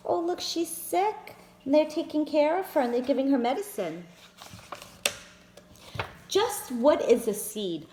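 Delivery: ordinary delivery, nothing marked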